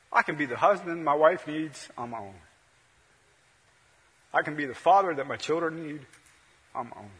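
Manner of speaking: ordinary talk, nothing marked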